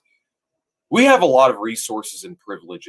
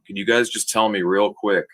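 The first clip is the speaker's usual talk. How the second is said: normal talking voice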